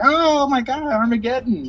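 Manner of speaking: high, panicked mock alarm; panicky freakout. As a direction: overreact like the sky is falling. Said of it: "they're kinda like".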